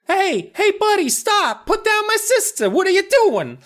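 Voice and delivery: shrill tiny gnome yelling stop; squeaky gnome shout. Acted. Gnomes yelling at the kidnapper, very high and loud. Gnomes yell as sister carried.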